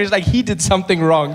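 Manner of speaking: ordinary delivery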